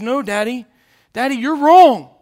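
lightly voiced child, rising and insistent; a hint of a boy refusing to believe; pleading little boy. Boy insisting to his father that Mommy sleeps, high and pleading.

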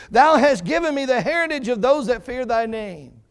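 high, loud, ringing proclamation; full-force preaching. Acted. Preacher proclaiming David's psalm to the congregation, loud and fervent. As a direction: proclaim the verse with everything you have.

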